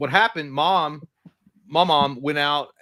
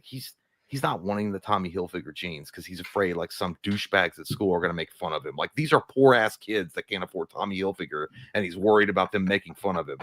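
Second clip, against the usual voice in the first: lower, grumbling uncle; uncle complaining to wife; 6 semitones lower than usual. Retold or imitated. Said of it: "it's like".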